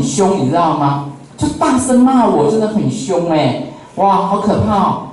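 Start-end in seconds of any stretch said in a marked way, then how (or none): none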